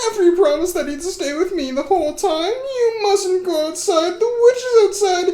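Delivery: very high, loud wailing; hysterical panic. Acted. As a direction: shriek and wail in terror for your husband. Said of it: "they heard Ava's panicked voice".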